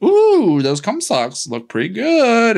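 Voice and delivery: high, slow, campy purr; breathy falsetto camp; mock sultry high voice. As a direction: camp it up as a tempted old lady. Debbie Reynolds voiced in retelling.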